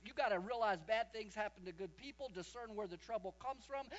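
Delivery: ordinary delivery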